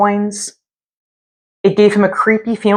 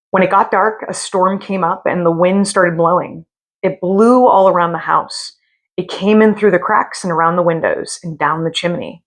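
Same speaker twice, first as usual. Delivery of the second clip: usual speaking voice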